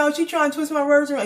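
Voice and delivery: high, fast mock complaint; retold indignant protest. Man complaining about her questioning, in mock indignation.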